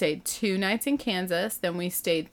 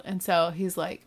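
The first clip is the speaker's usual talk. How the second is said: ordinary talk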